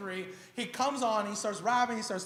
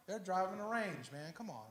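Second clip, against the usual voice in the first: low, much softer complaint; grumbling aside; 3 semitones lower, 9 dB quieter than usual. Acted. Believer envying others' cars, quiet disgruntled aside. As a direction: grumble it under your voice. Envious grumble about prospering unbelievers.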